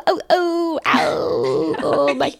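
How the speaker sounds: high hammed-up whimpers of pain; mock wailing over a hurt wrist; pathetic moaning. Handcuffed suspect complaining to police, pathetically moaning. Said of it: "he's, like, really pathetic about it".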